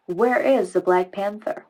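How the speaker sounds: put-on American news reporter accent. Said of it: "Do you know why I did an American accent?".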